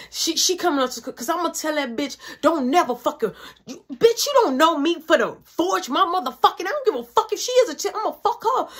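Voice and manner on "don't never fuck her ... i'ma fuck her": high-pitched retold rant; furious mom tirade, re-enacted; snapping out. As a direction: imitate a mother losing it, higher and higher. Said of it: "She's snapping out".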